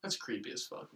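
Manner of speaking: normal talking voice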